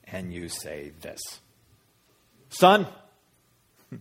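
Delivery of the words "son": loud, high, sharp call; angry parent. Acted. Mother to her found son, a little angry and loud. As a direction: call the child to account. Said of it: "you're a little angry".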